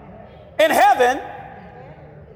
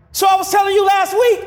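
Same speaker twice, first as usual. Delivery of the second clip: ordinary talk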